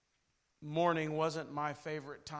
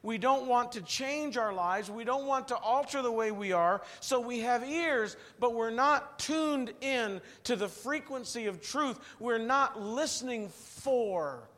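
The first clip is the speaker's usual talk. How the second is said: preaching; 6 semitones higher, 8 dB louder than usual. Acted. Preaching at full force.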